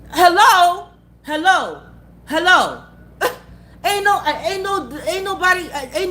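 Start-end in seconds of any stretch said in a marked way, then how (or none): 0.1-2.8 louder "hello?" as if rousing someone; loud mock calling. Real, not acted. To a viewer crying over an ex, loud teasing hello. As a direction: call out to wake them up.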